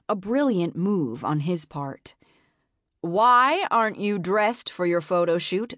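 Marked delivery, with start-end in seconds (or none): 3.0-5.7 sharp, nasal and commanding; harsh nasal voice. Acted. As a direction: bark it like a stern manager. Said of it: "Marion's harsh nasal voice".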